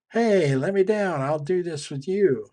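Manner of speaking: put-on high, loud playful child's request; little girl calling out. Girl on teeter-totter asks friend down, high voiced child. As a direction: call up brightly as a child in a story.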